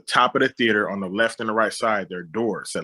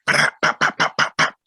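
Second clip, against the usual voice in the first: louder, punchy pop pop pop gunfire sounds; a quick burst of imitated shots, louder than the story; mock gunshots; 5 semitones lower, 4 dB louder than usual. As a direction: spit out the pops like gunfire going off.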